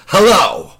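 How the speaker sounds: loud, high, exasperated phone answer; snapped. Retold or imitated. Harassed man answers yet another prank call, snapping. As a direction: imitate a man at breaking point barking a greeting. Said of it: "he finally snaps".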